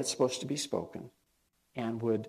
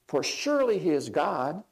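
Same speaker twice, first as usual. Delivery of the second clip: loud, high scoffing jeers; mocking taunt; 8 semitones higher, 5 dB louder than usual. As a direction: ridicule the false god's prophets, rising louder. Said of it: "he scoffed".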